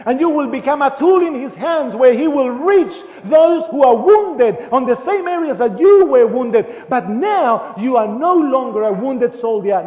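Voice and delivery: loud, high, fervent preaching; full-force preaching. Acted. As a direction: preach it out to the congregation.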